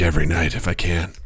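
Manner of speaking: low, hushed confession; whispered. Real, not acted. Gamer admitting to nightly video games, whispering. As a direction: drop it to a secretive near-whisper. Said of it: "don't know why I whispered that".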